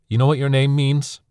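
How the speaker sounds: high, loud call to someone walking off; shouting after him. Acted. Son shouting after Anil as he leaves, loud. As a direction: throw it after him across the distance. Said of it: "I shouted after him".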